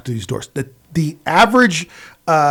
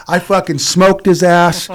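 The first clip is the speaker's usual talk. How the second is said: ordinary delivery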